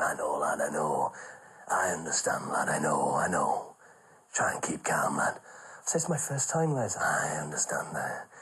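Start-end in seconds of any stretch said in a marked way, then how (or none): 0.0-5.3 put-on low, hushed old comic soothing a nervous lad; a low half-whisper, calming him down; low whispered reassurance from an old pro; Les Dawson reassuring. 6.0-7.0 nervous whisper. Retold or imitated. Own nervous reply whispered. 7.0-8.3 put-on low, hushed old comic soothing a nervous lad; a low half-whisper, calming him down; low whispered reassurance from an old pro; Les Dawson reassuring. Les voiced again.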